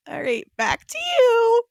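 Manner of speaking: high, drawn-out playful handback; field reporter sign-off. Pretend. Reporter handing back to studio, high sing-out sign-off. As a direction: toss it back high and stretched, like a mock newscast. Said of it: "to reporter Geese Peep out on the field".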